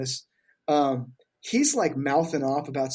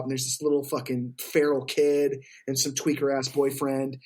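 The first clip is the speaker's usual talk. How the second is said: ordinary talk, nothing marked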